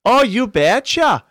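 put-on broad Wisconsin accent, high, loud and drawn out. Actor first trying out his catchphrase, hammed-up Wisconsin accent. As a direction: overdo a sing-song Wisconsin twang. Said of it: "has to be a Wisconsin accent".

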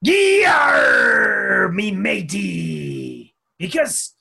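gruff shouted pirate, drawn out slowly. Pretend. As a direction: bellow it like a salty sea captain. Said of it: "Do it as the, uh, the pirate".

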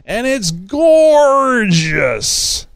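high, long drawn-out GORGEOUS; gushing. Acted. Host reading a fan's tweet about his red Jeep, gushing. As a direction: swoon over it, stretching the word right out.

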